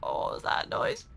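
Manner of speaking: hushed, nervous question; scared whisper. Real, not acted. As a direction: whisper so nothing hears you. Hears noise in dark game.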